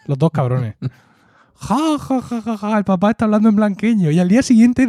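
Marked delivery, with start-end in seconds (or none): 1.5-4.1 high, laughing mock of the children; kids laughing. The kids mock dad through their laughter. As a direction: imitate the kids cackling with glee, higher. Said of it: "Llorando de la risa".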